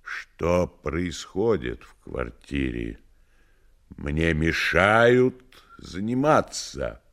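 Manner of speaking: slow, deep, heavy voice; a grumpy deep voice disturbed at work; heavy low voice. Acted. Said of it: "тяжёлый низкий голос".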